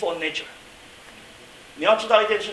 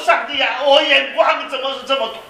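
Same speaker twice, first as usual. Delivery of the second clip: crying out; 9 semitones higher, 13 dB louder than usual. Acted. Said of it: "大哭啊".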